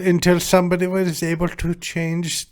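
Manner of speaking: put-on German accent. Explaining a drinking-game rule, in a mock German accent. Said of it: "speak in a German accent".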